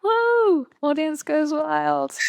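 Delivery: high, drawn-out playful whoop; a playful crowd roar for a friend's poem; mock cheering. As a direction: cheer like a whole audience. Mock cheering after the reading.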